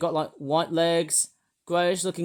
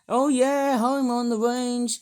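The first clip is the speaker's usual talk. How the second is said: country singer; 6 semitones higher, slower than usual. Acted. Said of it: "why do I sound like a bloody country singer".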